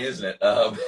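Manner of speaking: ordinary talk, nothing marked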